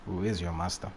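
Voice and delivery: ordinary delivery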